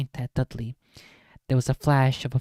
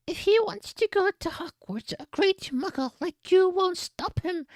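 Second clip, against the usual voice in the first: high mock giant voice, much higher; a put-on Hagrid growl pitched way up. A narrator's reading. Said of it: "growled Hagrid".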